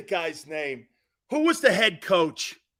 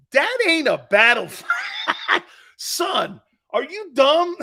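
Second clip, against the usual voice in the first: high, drawn-out mock outburst breaking into laughter; exasperated grandfather; 9 semitones higher, 4 dB louder, slower than usual. Grandfather scolding his grandson over the flag, exasperated. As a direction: play the incredulous grandpa, laughing through it.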